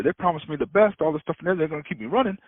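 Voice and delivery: normal talking voice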